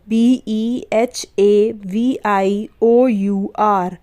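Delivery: very slow letter-by-letter spelling; spelling slowly. Shown as a demonstration. Teacher spelling behaviour very slowly for the class. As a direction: drag out each letter so pupils can write. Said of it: "इसे हम पढ़ते हैं behaviour".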